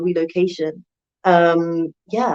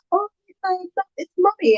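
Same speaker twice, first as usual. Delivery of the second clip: put-on sweet reassurance to child; 11 semitones higher than usual. Mother reassuring her startled three-year-old: high gentle coo. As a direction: coo it way up high so he's not scared. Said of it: "And I was like".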